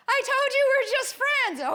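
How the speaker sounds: loud, high defensive yell; exasperated protest. Acted. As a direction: snap back at a jealous partner. Acted jealous quarrel over Glenn.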